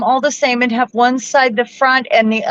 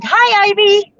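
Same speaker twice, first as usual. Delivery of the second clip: high, delighted hello; cheery greeting; 12 semitones higher, 3 dB louder, slower than usual. Real, not acted. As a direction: greet them in a lifted, laughing voice. Greeting someone on dog's behalf.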